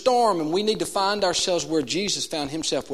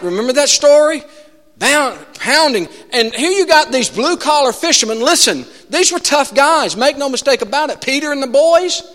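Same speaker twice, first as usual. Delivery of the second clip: higher, louder preaching build; 7 semitones higher, 5 dB louder than usual. Acted. Preacher stirring the congregation about the storm, raised preaching voice. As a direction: drive the storm story home from the pulpit.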